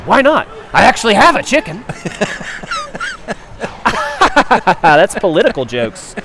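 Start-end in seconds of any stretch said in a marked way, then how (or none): 0.1-1.8 higher, louder comic punchline voice; mock Russian comrade. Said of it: "and one goes". 1.9-4.8 squealing real laugh; high cackling laugh. Real, not acted. Laughing at his own joke.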